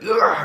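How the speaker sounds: high sharp gasp; hammed-up gasp; mock startled gasp. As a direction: gasp as if jolted by a memory. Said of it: "he's like".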